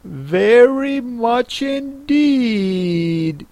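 put-on high, very slow girlish reply; little girl Alice. A narrator's reading. Alice accepts the Mock Turtle's offer, high and slow. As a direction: stretch it out politely, high.

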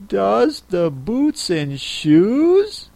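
slow, puzzled and wondering. A narrator's reading. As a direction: echo it in puzzlement. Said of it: "in a wondering tone".

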